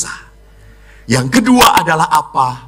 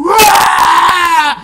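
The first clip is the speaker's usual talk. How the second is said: put-on high wailing cry of a child missing mama; mock child sobbing; 3 dB louder, much slower than usual. Priest imitates retreat kids wailing for mama, high mock sobbing. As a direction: wail like a sobbing kid at prayer night. Children crying at prayer night.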